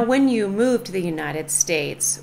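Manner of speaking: normal talking voice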